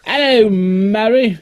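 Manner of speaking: high, slow Cockney Hello, Mary; stretched mock Cockney greeting; Cockney accent.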